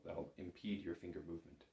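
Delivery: ordinary talk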